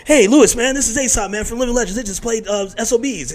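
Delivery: high-pitched imitation of an eager buddy; imitated eager introduction. Buddy introducing a musician to Louis, high and eager. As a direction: introduce your friend in a high, overeager voice.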